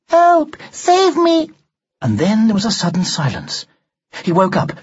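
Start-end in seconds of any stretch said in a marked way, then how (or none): 0.1-1.5 high, loud desperate scream; anguished cry for help. A narrator's reading. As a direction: cry out in terror from a nightmare. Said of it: "she was crying".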